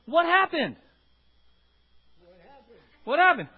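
loud, high alarmed asking; bewildered, demanding an answer; startled question. Retold or imitated. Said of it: "he goes to Betty".

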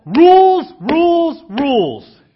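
three loud sneering cries of rules; mocking shout. Mocking the rules view of faith.

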